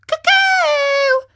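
very high, shouted cuckoo; mocking. A narrator's reading. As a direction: shriek the bird call to taunt them. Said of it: "mocking them".

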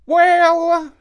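high loud crow drawl; old crow pondering; raspy cawing crow. Acted. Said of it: "said the crow".

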